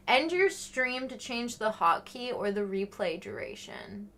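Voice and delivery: ordinary delivery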